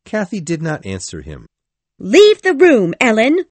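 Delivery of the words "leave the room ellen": very high, very loud, trembling rage; furious order. A narrator's reading. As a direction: scream her out of the room, shaking with fury. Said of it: "shaking all over".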